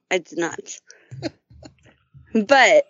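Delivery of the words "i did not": soft unvoiced denial; whispered. Real, not acted. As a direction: whisper it, almost embarrassed. Whispered denial about OnlyFans.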